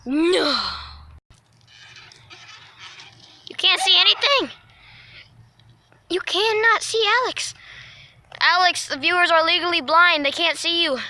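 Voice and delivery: loud, high exasperated yell; exasperated shouting. Real, not acted. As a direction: shout it, fed up and laughing. Exasperated yelling about the darkness.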